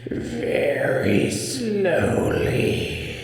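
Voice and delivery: slow, high, breathy crazy doll voice. Acted. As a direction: drag it out like a loony scarecrow. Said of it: "would answer in a crazy voice".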